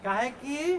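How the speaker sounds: "काहे कि" drawn out and left hanging; fill-in-the-blank prompt. Shown as a demonstration. Teacher cues class to finish the line, "काहे कि" left hanging. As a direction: stretch "काहे कि" slowly, leaving the rest open. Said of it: "dot, dot, dot".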